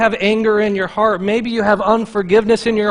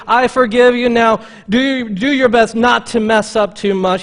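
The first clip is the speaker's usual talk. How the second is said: mildly louder, hammed-up easygoing Jesus; mock lenient Jesus; 5 semitones higher, 6 dB louder than usual. Preacher mocking a lenient Jesus, lightly hammed-up. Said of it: "He doesn't say".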